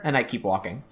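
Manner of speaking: ordinary delivery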